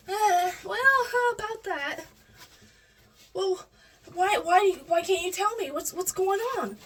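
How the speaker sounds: high, insistent mom voice, imitated; pushy mom. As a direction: keep pressing, pitch up high. Mom pressing for the secret.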